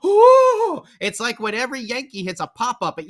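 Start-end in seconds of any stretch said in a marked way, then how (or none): 0.1-0.8 high, loud mocking crowd "ooh". Mocking Boston fans at every shot, loud high ooh. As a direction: squeal it like an overexcited fan. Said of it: "they were going".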